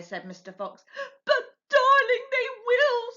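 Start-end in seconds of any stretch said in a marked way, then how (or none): 1.2-3.1 high, tearful sobbing plea. A narrator's reading. Mrs Fox pleading with Mr Fox, sobbing high. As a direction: sob it to your husband. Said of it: "sobbed Missus Fox".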